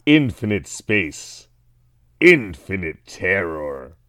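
slow, low sinister genie; Wishmaster voice. Pretend. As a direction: drawl it like an evil genie. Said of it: "You said terror like you were Wishmaster".

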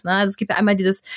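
usual speaking voice

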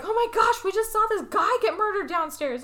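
high-pitched acted panic; mock freak-out. Girls reporting a murder downstairs, mock panic. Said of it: "the girls freak out".

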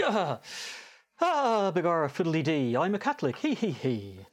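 stage Irish leprechaun, high and giddy; hammed-up Irish brogue with a giggle; leprechaun Irish accent. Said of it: "Apparently it also makes you Irish".

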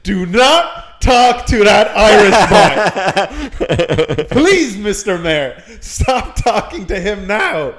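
slow, loud pleading lawyer; exasperated lawyer. Retold or imitated. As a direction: beg him to stop, exasperated. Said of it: "I heard Jacobs just exasperated".

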